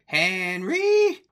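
shrieking landlady imitation, high and loud; high-pitched yell. Cartoon lady calling out Henry, high-pitched yell. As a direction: holler the name in a drawn-out high screech. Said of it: "where the lady yells in a real high pitch".

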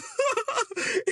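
loud laugh. Real, not acted. Laughing at her own story.

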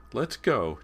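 high, loud little-brother plea; urging. A narrator's reading. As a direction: urge them to get moving. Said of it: "James urged".

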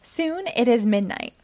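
plain reading voice

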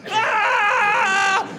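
high shouted war cry; sad Rambo yell. Retold or imitated. Comic with a broken cannon, high war cry. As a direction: yell like a failed action hero.

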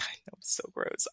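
embarrassed whisper. Real, not acted. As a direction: breathe it out, half laughing. Embarrassed aside after a burp.

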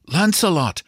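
high-pitched cheering without extra volume; high, excited repeated cry; wildly excited. A narrator's reading. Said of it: "Wild with excitement".

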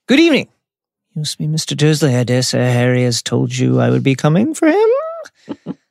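politely self-assured character voice; Dumbledore. Acted. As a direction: put on a genial, mannered caller's voice. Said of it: "said Dumbledore pleasantly".

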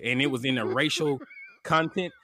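ordinary talk, nothing marked

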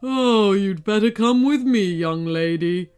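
griffin's loud, exasperated sigh into speech; long-suffering griffin; resigned sigh. A narrator's reading. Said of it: "rolled his eyes and sighed".

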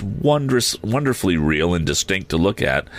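ordinary delivery, nothing marked

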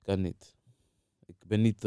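usual speaking voice

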